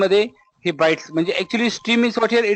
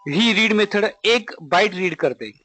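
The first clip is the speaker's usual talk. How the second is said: ordinary delivery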